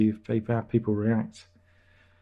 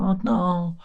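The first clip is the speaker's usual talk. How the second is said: higher, slow, whiny imitation of a pestering fan; a teasing put-on fan voice, higher and slow; mocking fan voice; 6 semitones higher, much slower than usual. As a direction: drag out the name like a fan moaning at you. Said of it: "I imagine that's how they talk".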